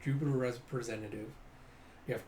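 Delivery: ordinary delivery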